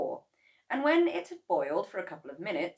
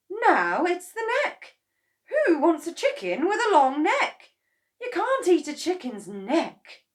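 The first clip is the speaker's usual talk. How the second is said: high, loud scolding; scornful; 12 semitones higher, 12 dB louder than usual. A narrator's reading. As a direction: scoff at the result. Mrs Kranky scornful.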